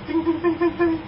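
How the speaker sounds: high, repeated bell dings; alert bell. Shown as a demonstration. Speaker mimics a radar alert, high ringing dings. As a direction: imitate a ringing alert in quick high notes. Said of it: "It's like that bell that we hear".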